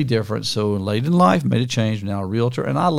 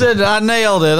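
much higher and louder, gleeful at guessing right; triumphant; 9 semitones higher, 4 dB louder than usual. Real, not acted. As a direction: crow over getting it right.

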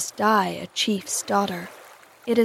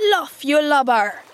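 slow, loud, higher Irish cry; 4 semitones higher, 5 dB louder than usual. A narrator's reading. Smee warning his pirate mate in the boat, Irish cry. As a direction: call it out loudly with an Irish accent. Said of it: "cried an Irish voice".

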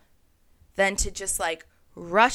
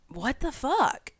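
drawn-out, higher exasperated "what the fuck"; baffled, slowed-down outburst; 7 semitones higher, slower than usual. Retold or imitated. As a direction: let the disbelief stretch out slowly. Exasperated reaction to the universe.

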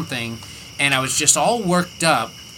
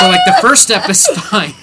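laughing; 8 semitones higher, 7 dB louder than usual. Real, not acted. Laughing while retelling the fall.

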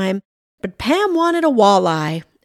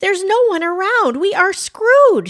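high, louder, panicked declaration; frantic; 12 semitones higher, 6 dB louder than usual. A narrator's reading. Pam alone as the man falls through ice, frantic. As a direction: wail it high, dragging the last word. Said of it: "looking frantic and scared".

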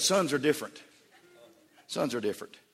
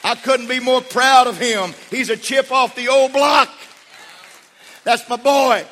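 loud, high, fervent preaching; preaching at full force; 17 dB louder than usual. Acted. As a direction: preach it to the rafters.

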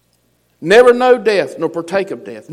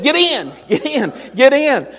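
calling in; 6 semitones higher than usual. Acted. Preacher voicing God calling in.

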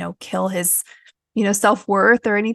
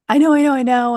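mimicking her son's loud, higher, dismissive "I know"; mock impatient child, louder and higher; impatient brush-off; 5 semitones higher, 6 dB louder, faster than usual. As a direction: toss it off like an annoyed kid who won't listen. Son brushing off mom's help.

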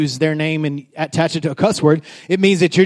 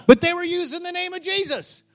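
high, softer mock objection; high-voiced puzzled complaint; mock protest; 12 semitones higher, 5 dB quieter than usual. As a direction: protest in a pitched-up voice.

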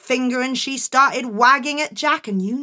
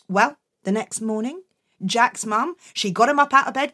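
usual speaking voice

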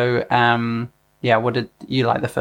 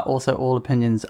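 normal talking voice

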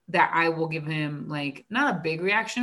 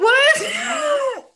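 high, loud laughing shout; very high cry of disbelief through laughter; incredulous outburst; 9 dB louder than usual. Retold or imitated. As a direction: shriek it in amused disbelief. Laughing disbelief at student's turnaround.

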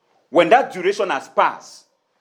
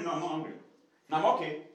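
hushed, offhand denial of anger; muted denial; 11 dB quieter, faster than usual. Acted. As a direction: play down the hurt, very softly. Acting a spouse denying being angry.

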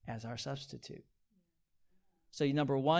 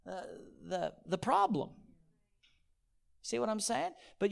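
ordinary talk

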